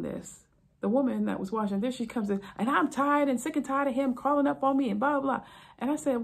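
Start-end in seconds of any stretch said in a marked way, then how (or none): 2.6-5.4 higher, louder fed-up rant; wife storming in, complaining. Retold or imitated. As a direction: vent about the husband.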